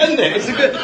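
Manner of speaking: normal talking voice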